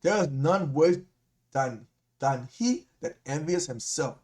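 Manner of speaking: slow, deliberate second reading; slow emphatic reread. Shown as a demonstration. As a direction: let each word land slowly.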